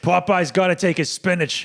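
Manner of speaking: high, loud cartoon sailor voice; Popeye. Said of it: "he has that, like".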